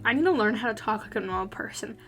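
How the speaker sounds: ordinary talk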